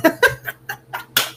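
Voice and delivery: squealing, breathless laughter; high helpless laugh. Real, not acted. Streamer cracks up at a chat joke, high squealing laugh. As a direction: lose it completely at the joke.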